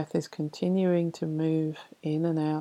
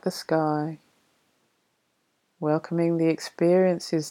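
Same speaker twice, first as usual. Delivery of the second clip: poem reading. Acted. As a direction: read the verse gently and unhurried. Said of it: "I'll read you a poem".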